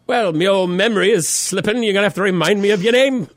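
put-on high, lilting leprechaun-like Irish accent; botched British accent, almost Irish. Host rehearsing for a forgotten acquaintance, botched British-Irish accent. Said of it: "that was almost Irish".

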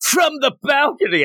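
high-pitched mock shout; yelling out. Podcaster as Power yelling to Denji as he walks out, mock shout. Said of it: "as she yells out to Denji".

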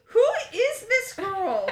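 high, loud, slow incredulous; mock alarmed. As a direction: ask it aghast about the girlfriend. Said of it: "I was like".

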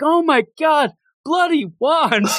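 high, laughing mock shout over bloody wands; excited yelling boy. Spectator screaming at the bloody wands, high laughing. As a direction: squeal it like a thrilled gossip in the crowd. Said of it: "He's like".

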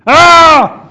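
loud, high scream of exasperation; exasperated scream. Acted. Stranger hearing the prankster's last call, exasperated scream. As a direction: scream as if pushed past breaking point. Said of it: "So that's exasperation".

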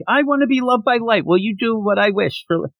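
put-on high, lightly girly Misa voice; a high girly voice, pleading for love; mock girly Misa. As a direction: squeak it up high like a lovestruck girl. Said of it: "And she's like".

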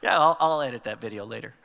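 real laugh through the words; laughing aside. Real, not acted. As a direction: chuckle at your own silly bit. Laughs at own joke.

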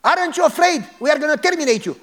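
the cop threatening to kill him; threatening police. Retold or imitated. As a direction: menace the pastor through the gate.

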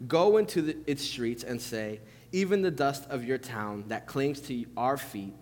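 measured, solemn Bible reading; scripture reading. Acted. Pastor reading the Gospel to the congregation, solemn reading.

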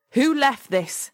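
loud, high shout across an office; shouted accusation, drawn out; angry shout. A narrator's reading. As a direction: demand it at the top of your voice. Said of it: "he shouted across the office".

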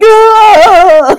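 high, loud shriek of laughter; laughing shriek. Retold or imitated. Brother convulsing on the floor.

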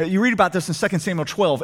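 ordinary talk, nothing marked

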